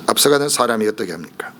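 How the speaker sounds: ordinary talk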